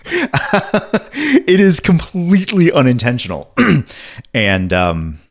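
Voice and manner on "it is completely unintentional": cracking, laughing voice. Real, not acted. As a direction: emotion cracking the voice. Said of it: "my voice is cracking".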